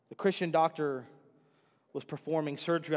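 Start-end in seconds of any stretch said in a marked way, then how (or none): none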